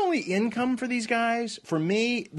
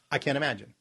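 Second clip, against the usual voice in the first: low, dry sarcasm; sarcastic; 7 semitones lower, faster than usual. Real, not acted. Cohost doubts the writer's motives, sarcastic. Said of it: "you were being sarcastic".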